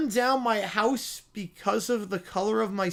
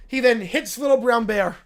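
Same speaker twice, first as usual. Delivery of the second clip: ordinary talk, nothing marked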